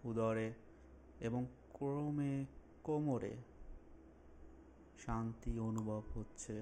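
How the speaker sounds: slow, drawn-out phrases with long pauses; unhurried relaxation instructor, lingering on soothing words; guided meditation. Acted. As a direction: guide them calmly through a body scan. Leads a guided body relaxation.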